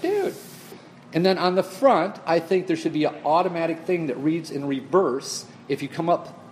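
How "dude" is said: exasperated dude. Retold or imitated. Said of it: "I'm like".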